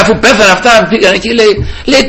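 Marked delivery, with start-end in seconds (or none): none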